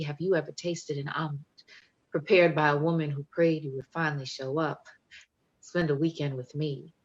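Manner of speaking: bold, rhythmic poetry performance with swagger; spoken-word poem. Acted. Poet performing Proper Love for the audience, confident spoken word. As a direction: perform it proud and playful, like a love demand.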